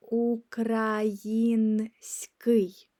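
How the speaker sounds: Український whispered, very slowly; slow unvoiced model of Український. Shown as a demonstration. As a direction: breathe out Український syllable by syllable. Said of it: "which means Ukrainian".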